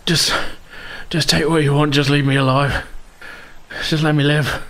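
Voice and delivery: high, pleading bandit voice, breaking into desperation; begging wounded bandit. Acted. Wounded bandit pleading with Ruby for his life. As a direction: beg for your life, terrified and hurting.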